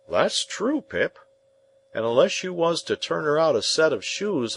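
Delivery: a hearty, homely smith; Joe the blacksmith. Acted. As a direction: speak as plain, plodding Joe, weighing every word.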